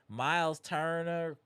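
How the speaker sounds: normal talking voice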